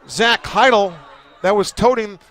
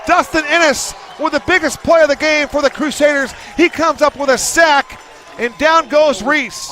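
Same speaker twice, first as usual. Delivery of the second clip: excited play-by-play; 6 semitones higher than usual. Real, not acted. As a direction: call the sack at full excitement. Big defensive play called live.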